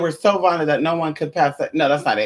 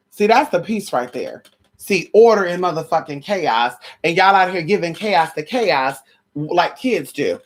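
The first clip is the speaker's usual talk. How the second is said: normal talking voice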